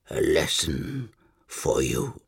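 low, slow gurgle through blood; gurgling croak. Acted. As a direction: force the words out through blood. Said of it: "gurgling his words through blood".

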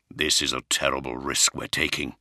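lightly voiced grim officer; tense, worried commander; grim warrior voice. Johnson speaks.

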